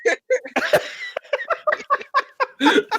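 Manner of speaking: high-pitched burst of laughter; squealing laugh at the joke; big laugh. Real, not acted. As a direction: crack up helplessly. Player laughing at cigar joke.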